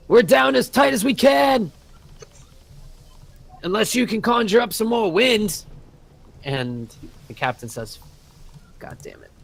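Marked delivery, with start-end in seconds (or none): 0.1-5.6 loud high yell from the bow; sailor yells. Acted. 8.8-9.3 whispered low curse; cursing under breath. Acted. Captain curses after sailor's cheek.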